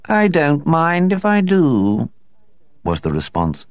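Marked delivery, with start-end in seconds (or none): 0.0-2.1 slow, detached cat voice; unbothered cat reply; even indifference. A narrator's reading. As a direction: drawl it with cool indifference. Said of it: "a tone of even indifference".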